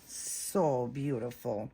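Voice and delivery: tender coo. Real, not acted. Cooing over the new doll.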